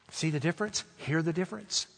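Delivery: ordinary talk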